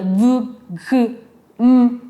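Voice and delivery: "V, G, M" as buzzing sounds, not letter names; "V, G, M" as isolated, voiced consonants. Shown as a demonstration. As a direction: let the vocal cords vibrate on "V, G, M". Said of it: "sí vibra".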